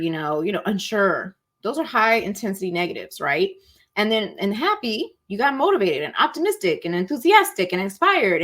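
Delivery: usual speaking voice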